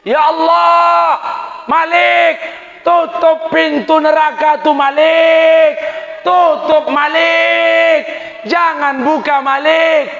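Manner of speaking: high, loud, drawn-out calling out; pleading shout. Acted. Prophet shouting to Malik to shut hell's gate, high and loud.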